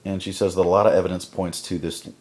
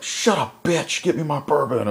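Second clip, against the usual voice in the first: much higher, raised-voice bark; acted villain yell; 10 semitones higher, 4 dB louder than usual. Frank ordering the woman about, mock furious yell. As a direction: snap the order like a raging villain.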